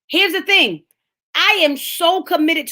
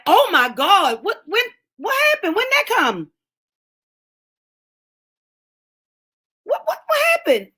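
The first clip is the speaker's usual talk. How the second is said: high squealing disbelief with a laugh; mock the shock, higher each time; astonished believer; 5 semitones higher than usual. Believer surprised by God's blessing, high squeal.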